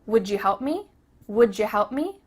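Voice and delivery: "Would you" linked as "wouldja"; quick casual request; fast reduced form. Shown as a demonstration. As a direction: run "Would you" into "woodja", rising. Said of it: "say it fast".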